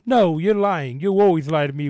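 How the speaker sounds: hammed-up tearful protest; loud pretend wail of denial. A narrator's reading. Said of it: "wailed Peppa".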